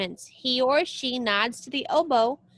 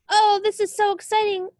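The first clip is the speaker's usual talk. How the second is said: excited; 8 semitones higher, 5 dB louder than usual. Acted. Child character excited at symphony.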